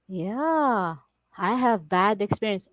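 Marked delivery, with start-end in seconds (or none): none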